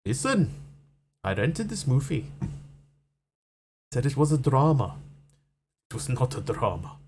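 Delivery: put-on South African accent, complaining. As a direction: caricature South African vowels. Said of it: "very South African is".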